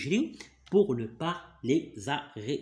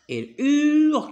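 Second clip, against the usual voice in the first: louder, higher, slow stressed hurle; emphatic; 10 semitones higher, 8 dB louder, slower than usual. Shown as a demonstration. Father stressing to his son that the grandma screams: loud, high and slow.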